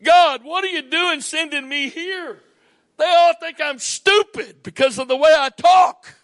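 high, loud pleading shout; retold complaint to God. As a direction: cry out to God in frustration. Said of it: "And I said".

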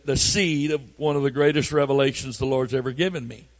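ordinary delivery

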